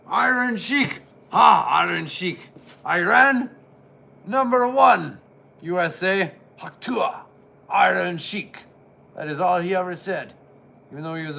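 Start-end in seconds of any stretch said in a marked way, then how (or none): 0.1-8.7 Iron Sheik shout. Pretend. Vlogger voices the Iron Sheik's catchphrases, loud barking. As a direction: chant your name like a proud heel.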